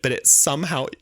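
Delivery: usual speaking voice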